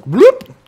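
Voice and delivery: sudden high, loud bloop; sharp upward bloop sound effect; imitated glitch bloop. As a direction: pop out a cartoonish glitch noise. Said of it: "internet goes like that".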